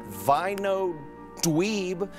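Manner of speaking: ordinary talk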